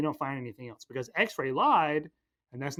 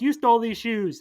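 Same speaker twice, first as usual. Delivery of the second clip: cops shouting an accusation, higher and louder; accusing shout; 6 semitones higher, 6 dB louder than usual. Retold or imitated. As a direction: bark it like an angry cop.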